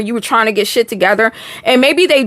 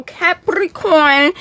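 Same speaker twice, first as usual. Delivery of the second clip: drawn-out announcement; 5 semitones higher, much slower than usual. Acted. Announcing the sign.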